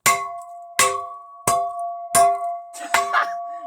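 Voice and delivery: loud airy burst of laughter; breathless laugh. Real, not acted. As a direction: laugh helplessly with no voice. After the smash.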